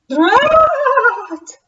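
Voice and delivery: high, loud annoyed exclamation; frustrated ghost. Acted. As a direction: spit it out in frustration.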